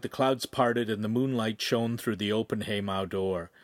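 ordinary delivery, nothing marked

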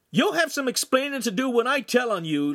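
put-on very high, indignant boy's yell; screamed threat. A narrator's reading. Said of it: "Lincoln screamed".

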